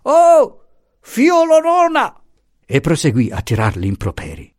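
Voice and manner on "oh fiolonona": loud, very high outburst; losing his temper. A narrator's reading. Pasotti loses his temper at the servant, exploding. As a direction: explode at her, drawing out the insult. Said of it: "uscì dai gangheri anche lui".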